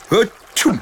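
big high sneeze, hammed up; loud dragon sneeze. Dragon sneezing at the little knight, loud and comic. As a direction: sneeze out the flames. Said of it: "Le dragon éternua".

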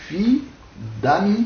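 "fi, dam" as two separate beats; syllable by syllable. Shown as a demonstration. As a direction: split "fi" from "dam" with a clear pause. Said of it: "deux syllabes correspondant à notre actuel fiant".

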